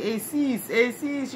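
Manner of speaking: high, teasing sing-song call of the name; mocking name-calling. Imitating people who poked fun at her name, mocking. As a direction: tease her the way the kids did. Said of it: "people making fun of me".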